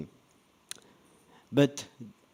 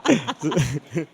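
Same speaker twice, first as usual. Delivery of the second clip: laughing. Real, not acted. Apologizing after a number slip.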